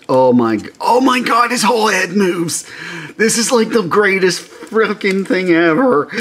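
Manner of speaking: high, loud delighted gush; real giddy excitement; excited squeal. Real, not acted. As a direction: gush over the toy, pitch way up. Excited about toy's moving head.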